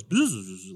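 low buzzing bee noise. Acted. Game master as bee introducing itself, buzzing. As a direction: buzz like a bee.

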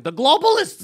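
high, loud hammed-up yell; cartoon yell. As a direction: scream it like a cartoon. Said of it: "He's a cartoon".

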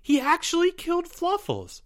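very high, shocked squeak; gasping. A narrator's reading. Timmy takes in Albert's confession, gasping. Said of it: "gasped Timmy".

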